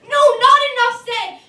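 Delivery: impassioned slam-poem retort; emphatic rebuttal. Acted. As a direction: cut back in forcefully, high and loud. Rebuts his own shouted climax.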